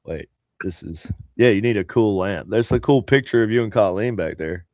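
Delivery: normal talking voice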